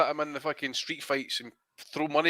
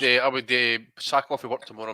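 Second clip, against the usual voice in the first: usual speaking voice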